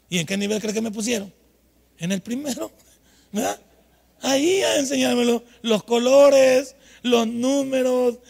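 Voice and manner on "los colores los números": very slow, louder, spelled-out words; slow teaching voice. Retold or imitated. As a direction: sound out each like a beginner's lesson. Imita clase de principiante. (voz lenta de clase de principiantes)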